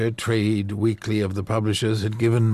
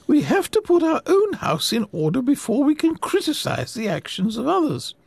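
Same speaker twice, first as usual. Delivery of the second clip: put-on high, earnest old lady voice, fervent; a gentle old woman preaching her cause; sweet old lady; 3 dB louder than usual. Offended old lady lecturing.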